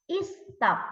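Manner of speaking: "is stop" in two beats, is, then top; wrongly syllabized. Shown as a demonstration. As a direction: put a false vowel at the front of "is stop". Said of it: "It's not is stop".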